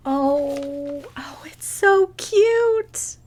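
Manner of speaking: high, slow whispered gush; delighted whisper. Real, not acted. Vlogger whispering in delight at a cute present. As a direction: breathe it out in awe, whispered and high.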